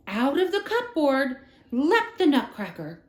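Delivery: ordinary delivery, nothing marked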